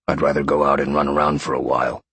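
low-pitched lion voice; lion grumbling a wish, low; restless inner lion. A narrator's reading.

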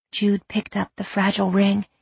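usual speaking voice

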